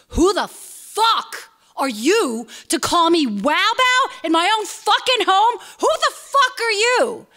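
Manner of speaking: high-pitched, slow outraged tirade; freaking out. Acted. Woman confronting a stranger at her own door, acted freak-out. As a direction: stretch each furious word, pitch soaring. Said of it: "freaked out on him".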